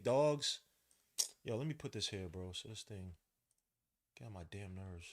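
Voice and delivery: ordinary delivery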